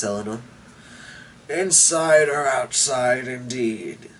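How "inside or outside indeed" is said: put-on slow, sour old man's grumble; grumbled. A narrator's reading. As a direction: grumble it to yourself, fading off. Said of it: "grumbled Shonks".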